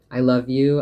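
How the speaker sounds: bursting out loud and high; loud declaration. Acted. Telling a loved one she loves them, calling it out loud. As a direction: shout the love out, then let it settle. Repeated love declarations to someone.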